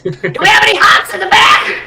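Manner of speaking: high, strained holler; yelling to the back. Acted. Shopkeeper asking the back room about hops, yelling. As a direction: call it through to another room.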